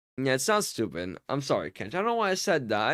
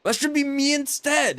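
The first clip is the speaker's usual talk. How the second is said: jealous friend; 11 semitones higher, 3 dB louder than usual. Retold or imitated. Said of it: "You're like".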